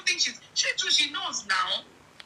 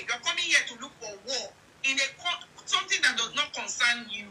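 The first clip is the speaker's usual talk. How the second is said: ordinary talk, nothing marked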